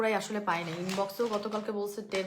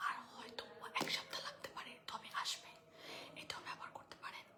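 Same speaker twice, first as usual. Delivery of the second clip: whispering softly; 6 semitones higher, 15 dB quieter than usual. Real, not acted. Seller whispering to live viewers during the call to prayer. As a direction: whisper the sales pitch under your breath. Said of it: "আজান দিচ্ছে তো তাই আস্তে আস্তে কথা বলছি".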